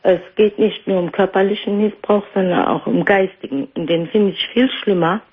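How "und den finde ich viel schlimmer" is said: usual speaking voice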